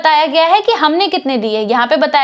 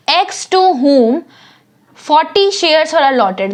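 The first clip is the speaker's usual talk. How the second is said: slow reading; much slower than usual. Shown as a demonstration. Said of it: "reading question text slowly".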